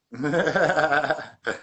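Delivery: hearty run of laughter; laughing. Real, not acted. Host amused by his own remark about a guest, laughing. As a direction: laugh it out in a quick string.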